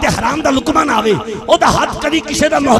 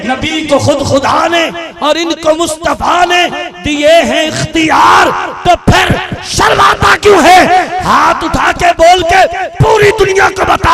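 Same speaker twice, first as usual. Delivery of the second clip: loud rousing preacher cry; fervent sermon shout; preaching at full force; 4 semitones higher, 5 dB louder than usual. Acted. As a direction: fire up the crowd.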